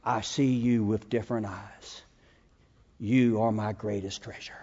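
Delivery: the dog's loving vow to its master, slow and hushed; tender dog's voice. Pretend. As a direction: hush it like a dog's deepest devotion. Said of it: "what he's telling you is".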